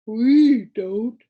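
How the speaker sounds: loud group shout; shouting together. A narrator's reading. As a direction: shout it back all at once. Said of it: "shouted the Lithargians all at once".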